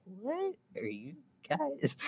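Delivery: slow, soft, high mock bewilderment; hushed, drawn-out confused imitation; puzzled passerby. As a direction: imitate a dazed stranger, drawing each word out. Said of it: "he's just like".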